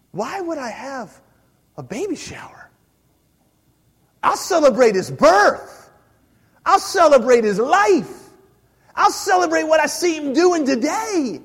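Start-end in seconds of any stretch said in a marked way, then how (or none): 4.2-11.4 loud, ringing proclamation; preaching at full force. Real, not acted. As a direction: declare it to the whole room.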